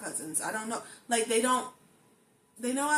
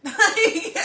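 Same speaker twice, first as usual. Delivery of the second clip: laughing; 9 semitones higher, 12 dB louder than usual. Real, not acted. Bursts laughing at herself.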